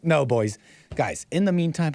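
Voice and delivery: normal talking voice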